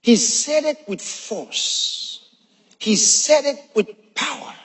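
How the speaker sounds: slow, deliberate preaching; slow emphasis. Real, not acted. Preacher slowly stressing the point to the church. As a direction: drag it out for weight.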